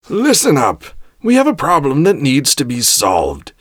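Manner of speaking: high, forceful call to order; shouted command. A narrator's reading. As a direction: shout down a room of subordinates. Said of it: "she shouted at them".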